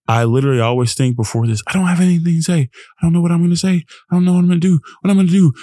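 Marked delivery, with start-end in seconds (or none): 1.7-5.5 mock panicked thoughts. To himself before the show, in mock panic. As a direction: replay the pre-show panic, hammed up a little.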